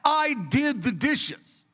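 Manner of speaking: put-on high, slow little-boy voice; proud six-year-old announcing his chore; little son. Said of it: "He goes".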